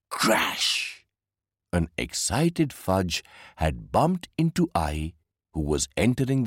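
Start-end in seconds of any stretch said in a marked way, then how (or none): 0.1-1.0 unvoiced whispery crash noise; imitated crash. A narrator's reading. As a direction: make the crash as a noisy burst of breath. Story sound effect.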